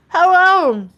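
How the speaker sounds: high, loud singsong hello; bright greeting. Real, not acted. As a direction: sing the hello out to a friend. Greeting a viewer in chat.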